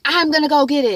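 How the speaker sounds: eager husband. Retold or imitated. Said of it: "He's like".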